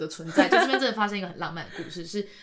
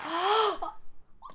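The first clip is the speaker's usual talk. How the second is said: gasp; 10 semitones higher than usual. Retold or imitated. Shock at own slip in story.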